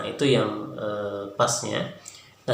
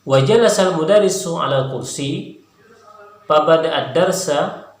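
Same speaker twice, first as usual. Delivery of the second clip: clear, slightly raised reading voice; reading text aloud; 3 semitones higher, 3 dB louder than usual. Shown as a demonstration. Teacher reads the Arabic text aloud for students to follow along. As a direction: read the passage for students to follow.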